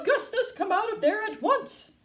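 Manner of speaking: high, scolding mother's yell; high frantic command. A narrator's reading. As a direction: order your child out, pitch high. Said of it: "yelled Missus Gloop".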